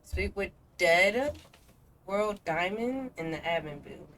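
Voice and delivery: normal talking voice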